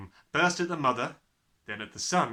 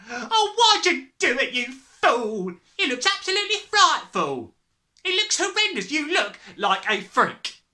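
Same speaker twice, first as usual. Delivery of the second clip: high, loud horrified scolding; shrieking; 11 dB louder than usual. A narrator's reading. Mother appalled by her husband's hair, shrieking. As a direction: shriek abuse at him. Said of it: "shrieked the mother".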